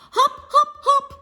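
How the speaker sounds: very high, loud hop calls; happy hopping. A narrator's reading. Grandma cheering during the sack race, high and loud. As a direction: bounce each hop out happily. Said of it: "she said happily".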